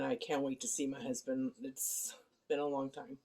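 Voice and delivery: usual speaking voice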